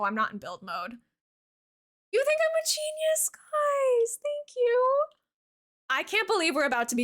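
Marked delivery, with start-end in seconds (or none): 2.1-5.0 much higher, playful and bright; giddy high-pitched thanks; high squeaky delight. Real, not acted. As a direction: squeal it to chat. Streamer reacting to chat praise.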